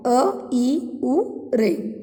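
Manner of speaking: अ, ई, उ, रे said as separate one-beat vowels; short vowels. Shown as a demonstration. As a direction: sound out अ, ई, उ, रे one at a time, like a teacher. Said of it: "एक मात्रा का".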